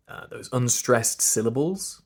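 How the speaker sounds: ordinary talk, nothing marked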